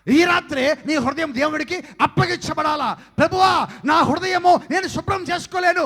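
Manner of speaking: loud, high, slow fervent prayer shout; preaching at full force. Acted. Preacher calls the young people to pray, shouting fervently. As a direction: cry it out to the Lord with full force.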